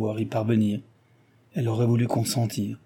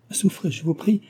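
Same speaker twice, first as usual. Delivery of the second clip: higher, polite interjection; a pitched-up, courteous interruption; interrupting; 8 semitones higher than usual. A narrator's reading. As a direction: cut in with a pleading request. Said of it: "dit-elle en l'interrompant".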